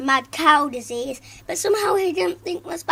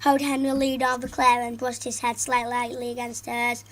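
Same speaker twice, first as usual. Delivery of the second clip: ordinary delivery, nothing marked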